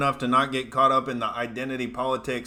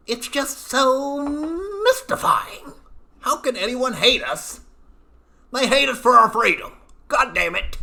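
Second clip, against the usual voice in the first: hammed-up high sarcastic whine; sarcastic mock bafflement; 11 semitones higher, 3 dB louder than usual. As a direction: feign wide-eyed disbelief to mock. Mock bafflement at being hated.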